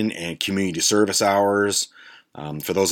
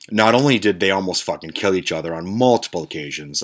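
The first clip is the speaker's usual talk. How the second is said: ordinary talk, nothing marked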